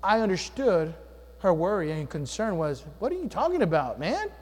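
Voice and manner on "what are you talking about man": higher, louder mock-outraged scolding; imagined wife scolding. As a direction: play the exasperated wife, hammed up. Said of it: "You know, she didn't say that".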